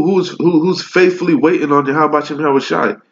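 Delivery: normal talking voice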